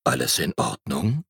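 low, soft bear asking gently; a big bear checking on the boy; deep bear voice. A narrator's reading. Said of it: "sagte eine tiefe Stimme".

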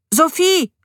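loud high call of her name from outside the house; boy shouts for his stepmother, eager to show his find. A narrator's reading. Boy calls Sophie from outside, loud and eager. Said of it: "rief er noch".

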